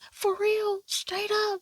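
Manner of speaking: high-pitched naive disbelief; innocent little brother. Retold or imitated. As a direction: ask it high, all wide-eyed innocence. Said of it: "just so innocent that he's like".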